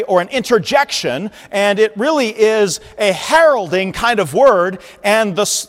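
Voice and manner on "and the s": ordinary talk, nothing marked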